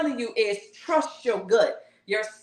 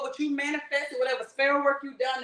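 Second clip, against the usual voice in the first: ordinary talk, nothing marked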